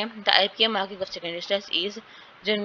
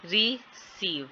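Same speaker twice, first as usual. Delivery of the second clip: "receive" slowly split, RE then ceive; deliberate two-part REE-ceive for "receive"; stress on RE; 4 dB quieter than usual. Shown as a demonstration. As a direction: draw out RE in "receive". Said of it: "इस पे stress है".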